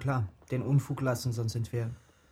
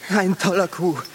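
loud, high, elated; excited triumph; 6 semitones higher, 8 dB louder than usual. Acted. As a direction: gloat over the haul with rising excitement. Boys celebrate their successful theft. (begeistert triumphierend)

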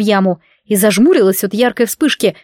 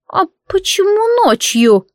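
high, slow prying crone; old hag; 11 semitones higher, slower than usual. A narrator's reading. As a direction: draw it out like a nosy old woman. Old neighbour questions.

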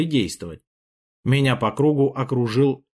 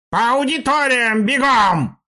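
sharp, high, slow creaky bark; creaky shout; 11 semitones higher, 5 dB louder, slower than usual. A narrator's reading. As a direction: yell the order in a raspy, stern teacher's voice. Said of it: "коротко крикнула скрипучим голосом". (скрипучий резкий крик)

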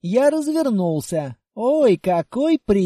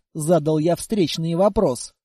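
plain reading voice

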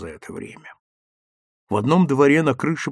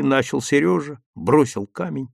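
ordinary delivery

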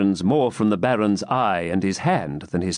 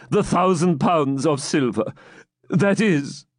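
higher pleading voice; pleading old man; 7 semitones higher than usual. Acted. Captive bargaining with the baron, pleading. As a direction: beg for your life.

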